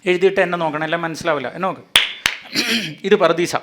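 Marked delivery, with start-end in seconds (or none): 3.0-3.6 loud, rousing pulpit delivery; preaching at full force. Real, not acted. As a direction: drive the point home to the crowd. (ആവേശത്തോടെ പ്രസംഗിക്കുന്നു)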